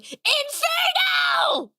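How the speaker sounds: high, drawn-out anime battle cry; magical girl scream. Retold or imitated. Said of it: "the only line that I remember is just screaming".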